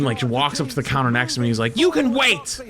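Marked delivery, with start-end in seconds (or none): 1.8-2.6 much higher, louder mock old-lady snap; berating. Old lady berating the teller at the counter, high mock scolding. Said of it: "he starts berating him".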